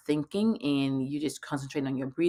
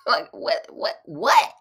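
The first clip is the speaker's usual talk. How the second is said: incredulous; 5 dB louder, slower than usual. Retold or imitated. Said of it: "I'm like".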